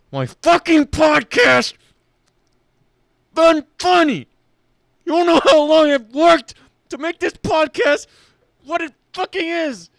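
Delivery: high, worked-up indignation; punched-out, offended rant; angry defence. Real, not acted. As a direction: defend your hard work furiously. Defends podcast against insults.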